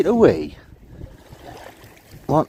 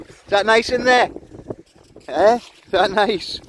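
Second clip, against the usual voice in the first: talking to a dog; 10 semitones higher, 3 dB louder, slower than usual. Real, not acted. Talking to the dogs.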